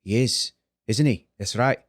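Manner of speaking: low, tentative Irish accent; Irish accent attempt. Shown as a demonstration. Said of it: "let me do an Irish accent".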